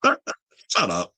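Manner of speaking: laughing out a dismissive "shut up". Real, not acted. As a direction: brush it off through a laugh. Laughing at a comment.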